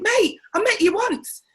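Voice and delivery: high, loud, incredulous mate; incredulous retort. Retold or imitated. Brother-in-law rebuffs a pushy relative, incredulous and loud. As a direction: toss it back at him, half laughing in disbelief.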